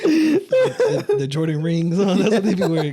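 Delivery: high-pitched helpless laughter through words; cracking up. Real, not acted. Host roasting Dallas players' Jordans, cracking up. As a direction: talk while losing it laughing.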